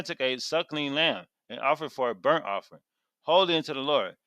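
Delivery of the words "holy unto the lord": ordinary talk, nothing marked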